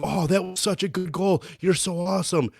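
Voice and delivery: high, sarcastic fake praise. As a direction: gush over the goal with heavy sarcasm. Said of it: "and I go".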